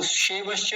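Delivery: शेवश्च run together with plain e and no y; mistaken pronunciation. Shown as a demonstration. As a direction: rush शेवश्च as one word, dropping the य. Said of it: "नहीं बोलेंगे". (शेवश्च, य गायब करके)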